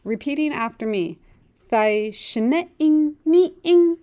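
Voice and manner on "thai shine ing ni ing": "Thai shine-ing ni-ing" slow and louder, tones lifted; slow model sentence. Shown as a demonstration. Teacher leads class through full sentence, slow and careful. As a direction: model the whole line slowly to copy. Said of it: "Let's do the whole sentence now".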